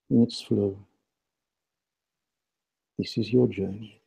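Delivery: right in your ear. Acted. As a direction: guide them inward, hushed and close. Leads guided meditation with breathing.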